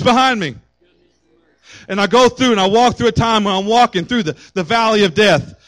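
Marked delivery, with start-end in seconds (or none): none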